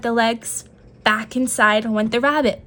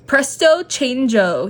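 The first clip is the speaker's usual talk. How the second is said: slower, grand magic words; crying out the spell; 2 semitones higher, slower than usual. A narrator's reading. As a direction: intone the spell with a flourish. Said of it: "he cried".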